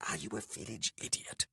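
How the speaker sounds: hushed, incredulous reproach; mouthed. A narrator's reading. Talon berating Beatrax near the guards, mouthing it. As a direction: scold him almost without voice. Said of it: "mouthed Talon".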